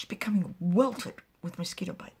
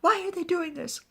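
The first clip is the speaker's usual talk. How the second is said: high, raised acted lament; tearful; 11 semitones higher, 5 dB louder than usual. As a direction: cry out as if about to burst into tears. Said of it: "breaks into tears".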